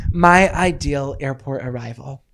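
slow dramatic title reading. Shown as a demonstration. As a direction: announce the title grandly. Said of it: "monologue I wrote, if I could read it".

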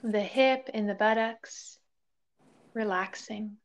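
calm body-scan voice with long pauses; guided meditation. Real, not acted. Yoga teacher leading listeners through a body scan, guided meditation. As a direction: guide them gently into stillness, leaving space between phrases.

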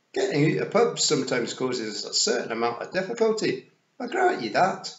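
loud, cheerful Scots pictsie; Rob Anybody. Acted. Rob granting a difficulty with pubs, cheerful Scots voice.

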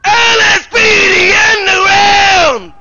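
shouting. Acted. Police raid, shouting orders.

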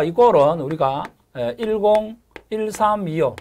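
ordinary delivery

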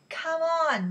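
high, loud call; urgent cry. A narrator's reading. Moshe hurries Ellie below deck, crying out. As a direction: cry out, stretching the words. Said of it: "Moshe cried".

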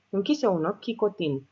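ordinary delivery, nothing marked

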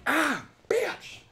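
high yelp then curse on landing; high pained curse. Retold or imitated. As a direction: hit the ground and yelp. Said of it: "And I'm like".

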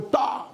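sharp vocal bang; imitated gunshot. Hunter telling how he shot the duck, imitated bang. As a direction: fire the shot with your voice.

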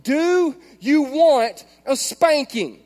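loud, high, very slow hammered question; mock parental threat. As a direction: act out a yelling dad, stretching every word. Repeats his yelled threat to daughter.

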